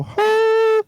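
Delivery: high ringing "ha" tone; imitation of a ringing glass; imitated wine-glass note. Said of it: "when you, like, go".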